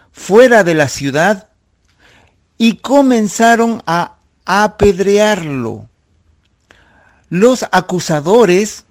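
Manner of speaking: preacher reading scripture with rising drama; dramatic scripture reading. Acted. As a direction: read the martyrdom of Esteban with fervour. Reads Acts passage aloud.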